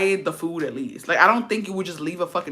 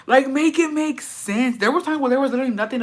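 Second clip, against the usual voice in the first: exasperated; 7 semitones higher than usual. Real, not acted. Exasperated complaint.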